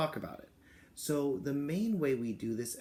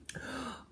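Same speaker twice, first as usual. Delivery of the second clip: gasp; 11 semitones higher, 9 dB quieter than usual. Real, not acted. Delighted gasp at a picture.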